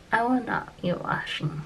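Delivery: usual speaking voice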